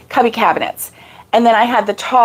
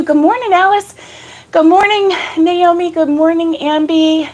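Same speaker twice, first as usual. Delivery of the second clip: excited greeting; 6 semitones higher than usual. Real, not acted. Greeting live chat.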